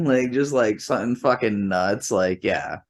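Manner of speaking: ordinary talk, nothing marked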